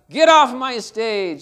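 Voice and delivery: slow, loud mock command; mock order. Pianist orders boy off the stage, slow mock angry command. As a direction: bark it, half-serious.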